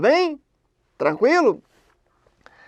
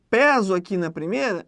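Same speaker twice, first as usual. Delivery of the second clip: normal talking voice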